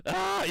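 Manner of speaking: very high, loud hammed-up yelp; mock disgusted shriek. Teen reacting to awful beer, loud high mock shriek. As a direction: shriek like the taste burns.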